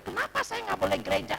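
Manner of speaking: mock-indignant, high-pitched protest; imitating your own objection in a squabble; retold arguing. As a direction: retell an argument, pitched well up. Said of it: "Saya sampai sempet ribut sama dia".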